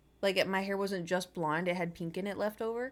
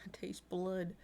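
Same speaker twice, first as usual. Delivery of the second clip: breathy whisper, slow; 8 dB quieter, slower than usual. Real, not acted. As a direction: murmur it under your breath. Torn cheek from braces.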